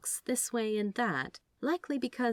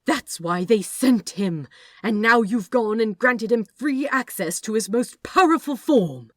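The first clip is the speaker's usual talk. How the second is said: loud, higher furious rebuke; angry scolding; 5 semitones higher, 10 dB louder than usual. Acted. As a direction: pile on the blame. Angel scolding another angel.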